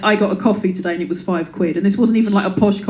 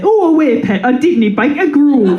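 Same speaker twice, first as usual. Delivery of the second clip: loud, high, slow silly voice; 6 semitones higher, 5 dB louder than usual. Retold or imitated. Comic dodging her therapist with a loud, high silly voice. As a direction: dodge the question with a daft voice. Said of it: "And I say".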